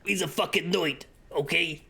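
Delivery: pushy, hammed-up New Yorker patter; New Yorker accent. Buyer telling shopkeeper about relative, mock New Yorker accent. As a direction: explain it as a cartoon New Yorker. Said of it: "the New Yorker accent in my head".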